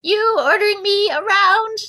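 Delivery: high, slow mock Anna voice; reading Anna. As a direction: whine it in her voice. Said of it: "I will read them".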